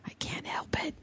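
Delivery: whispered confession, almost inaudible; a sheepish whispered admission to listeners. Real, not acted. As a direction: drop to a guilty whisper as an aside. Sheepish aside about Rami Malek obsession.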